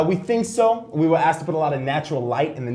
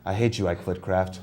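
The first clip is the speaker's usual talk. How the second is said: mock bitter loser; 9 semitones lower, 6 dB quieter than usual. Loser vows revenge.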